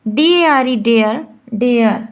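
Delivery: डी ए आर ई spelled, then डेयर said twice; spelling out a word. Shown as a demonstration. Teacher spelling out a word for the class, slow. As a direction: spell slowly then pronounce. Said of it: "ए आर का sound आ रहा".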